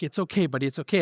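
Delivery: soft, gentle reassurance; quietly comforting a crying daughter at bedtime; soothing a child. Retold or imitated. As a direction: hush a sick little one softly. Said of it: "and, like".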